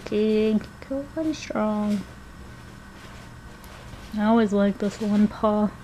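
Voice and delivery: hushed, tender cooing at a cat; soft cooing. Real, not acted. Cooing softly over old cat.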